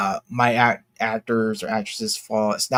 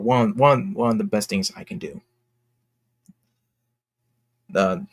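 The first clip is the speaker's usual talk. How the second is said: ordinary talk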